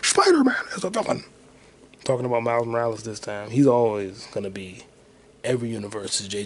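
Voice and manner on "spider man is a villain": loud, high blustering editor voice; Jonah Jameson impression. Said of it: "Jonah Jameson again".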